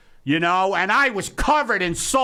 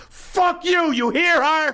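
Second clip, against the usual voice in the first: yelling at a stranger; 10 semitones higher than usual. Retold or imitated. Owner yelling beside his dog at a stranger. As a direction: egg the dog on with a yell. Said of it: "I'm next to Charlotte saying".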